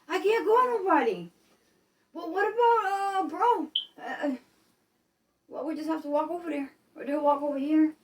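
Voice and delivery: high, loud and frantic, calling for Ma in the dark; a scared grown kid yelling through the house when the power dies; high loud panic, a sob breaking into the candle question; panicked family member. Acted. Acts panicking family member in blackout.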